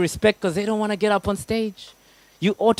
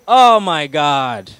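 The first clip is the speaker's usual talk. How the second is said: mock awe; 4 semitones lower, 6 dB louder, much slower than usual. Mocking spiritual exclamation.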